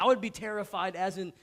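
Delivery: usual speaking voice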